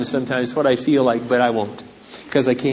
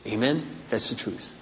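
normal talking voice

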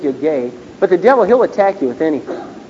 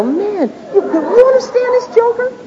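much higher, hammed-up incredulous grumble; mock grumbling student; slower than usual. Student grumbling to Cliff about the lecturer, hammed-up. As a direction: act out a fed-up classmate complaining.

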